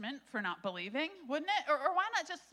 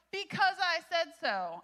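stern parent; 7 semitones higher, 6 dB louder, slower than usual. Acted. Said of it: "Any parents in the room".

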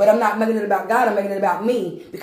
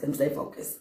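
whispered to self; 6 semitones lower, 9 dB quieter, faster than usual. Real, not acted. Preacher whispering himself back on track. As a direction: whisper "Let me stay focused" to yourself, much softer.

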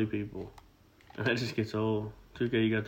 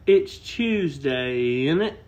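high, slow, hammed-up British accent, louder; mock British accent; 4 semitones higher, 7 dB louder, much slower than usual. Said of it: "That was good".

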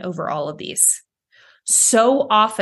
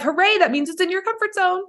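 sarcastic cheer, pitched high; high mock celebration; mock hooray; 10 semitones higher than usual. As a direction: cheer it up high, tongue in cheek. Sarcastic cheer about survival.

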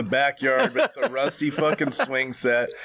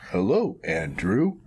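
lower, slow doll voice; lightly voiced creepy doll; flat-voiced sex doll greeting; a hint of robot voice; 8 semitones lower, slower than usual. Doll's robotic voice.

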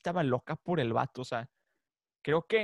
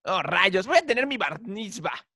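funny cartoon boy imitation, pitched up; Harold; 6 semitones higher than usual. Cartoon boy fretting over his bar mitzvah, funny voice. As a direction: imitate a comical cartoon kid in sudden dismay. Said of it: "Aparte hablaba bien chistoso".

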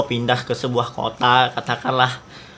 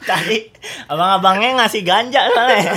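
giggly high voice, laughing; stoned; 7 semitones higher than usual. Pretend. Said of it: "lagi nyimeng".

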